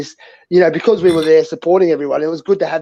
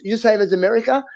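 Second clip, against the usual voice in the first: pitched-up imitation of a Chinese man; imitated Chinese man; 6 semitones higher than usual. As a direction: mimic a Chinese man's question. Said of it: "we had this little Chinese man walk up to us".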